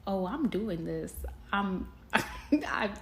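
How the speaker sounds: amazed she went through with it; excited disbelief. Retold or imitated. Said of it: "I was like".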